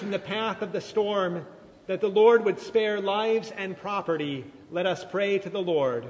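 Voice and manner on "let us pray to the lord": ordinary talk, nothing marked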